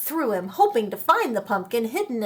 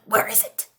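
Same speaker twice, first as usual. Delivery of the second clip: whispering; 2 semitones lower than usual. Acted. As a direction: whisper the question. Old man muttering continued.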